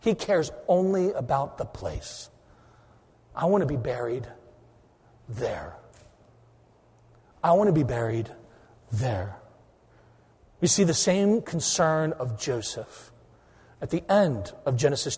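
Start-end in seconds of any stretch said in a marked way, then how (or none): 3.4-9.4 hushed dying wish. Acted. Preacher voicing dying Jacob's final wish, hushed and low. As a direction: say it quietly like a dying man's last request.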